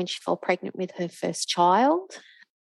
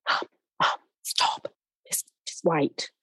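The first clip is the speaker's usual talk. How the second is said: retold hushing. Retold son hushing mum on phone.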